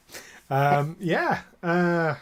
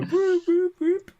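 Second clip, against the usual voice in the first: high mock alarm whoop, slowed; 4 dB louder than usual. Game master voicing the ship's alarm as a high, mock whoop. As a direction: imitate a starship alert siren.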